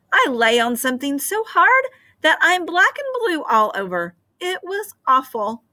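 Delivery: a princess fussing about her bruises; complaining princess. Acted. Princess tells the queen about her night, mock complaint.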